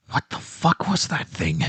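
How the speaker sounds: ordinary delivery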